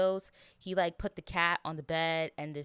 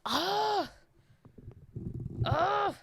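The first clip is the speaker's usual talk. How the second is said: choked sob; 13 semitones higher than usual. Real, not acted. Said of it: "So sorry, you guys. I've never done this on the podcast".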